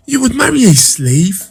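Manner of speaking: high, shocked mother voice gasping out the words; a scandalised mother, aghast; gasped outrage. Acted. His mother gasps at Dimpa's choice, high and shocked.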